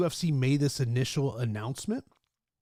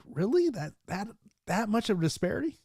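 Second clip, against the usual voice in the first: higher-pitched, halting disbelief; incredulous reaction; 6 semitones higher than usual. Retold or imitated. Host reacting to lopsided fighter salaries, incredulous. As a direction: question it in mild mock surprise.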